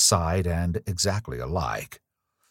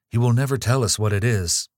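fearful, louder outburst; anxious outburst; 5 semitones higher, 5 dB louder, faster than usual. A narrator's reading. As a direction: blurt it out in fear of the secret. Frightened girl about the secret.

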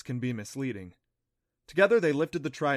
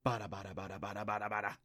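low, soft creature chant; creature whispers; 4 semitones lower, 8 dB quieter, faster than usual. A narrator's reading. Unseen creatures whispering from the corner, low and soft. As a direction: murmur it like small hidden creatures.

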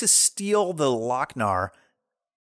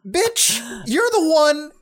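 high, incredulous comeback; mock indignation; 8 semitones higher than usual. Man retorting to the accusing queen, mock indignation. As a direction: throw it back in hammed-up outrage, starting very high.

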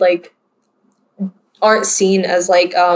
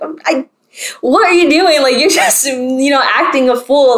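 higher-pitched, indignant retold scolding; indignant retort; 5 semitones higher than usual. Middle schooler to kids mocking her, indignant. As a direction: pitch it up, calling out their foolishness.

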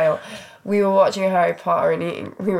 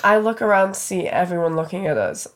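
ordinary talk

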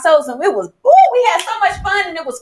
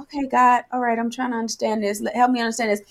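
softer, lower, murmured aside to God; private prayer; 4 semitones lower, 7 dB quieter, faster than usual. Retold or imitated. Woman asking God to help her understand, quietly puzzled. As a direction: talk it through under your breath.

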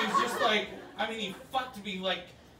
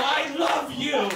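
slow, high, loud love confession; mock tender confession; 7 semitones higher, 7 dB louder, slower than usual. To the masseur while holding his hand, slow and pleading. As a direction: hold the words out like a clingy lover. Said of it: "and you go".

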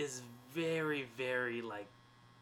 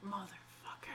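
unvoiced whispered swear; whispered curse when the crush has a date; 6 semitones higher, 12 dB quieter than usual. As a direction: breathe the curse under your breath. Retold cursing under breath.

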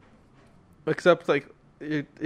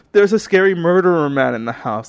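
put-on frightened kid voice, louder; scared child; 5 semitones higher, 8 dB louder than usual. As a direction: blurt it out terrified. Scared child crying to mom.

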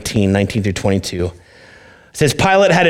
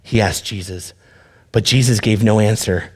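usual speaking voice

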